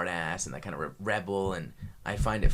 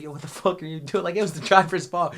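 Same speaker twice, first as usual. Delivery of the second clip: imitating a pissed sergeant, pitched up; retold cussing out; 7 semitones higher, 4 dB louder than usual. Imitating sergeant blaming the driver, angry cussing out. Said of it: "he cusses the driver out".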